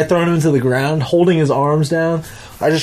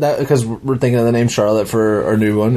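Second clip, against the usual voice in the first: ordinary delivery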